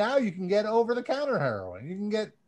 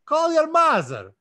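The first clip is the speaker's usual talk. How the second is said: loud, higher hammed-up Russian accent; 5 semitones higher, 5 dB louder than usual. Reading out fan shoutouts on the podcast in a hammed-up Russian accent. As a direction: ham up a thick Russian accent. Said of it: "I'm gonna do it with a Russian accent for you".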